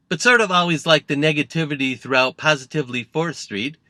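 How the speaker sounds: put-on voice, drifting on from thought to thought; Trump. Politician answering a nasty reporter's question, Trump impression. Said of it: "to which Trump responded".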